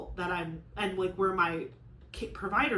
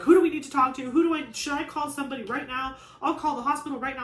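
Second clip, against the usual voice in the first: imitating a fired-up husband, louder and higher; 5 semitones higher, 6 dB louder, faster than usual. Imitating her husband ready to call the hospital, fired up. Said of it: "he's just ready to, like, tear down buildings".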